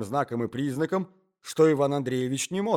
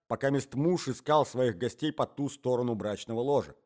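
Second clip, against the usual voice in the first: ordinary delivery, nothing marked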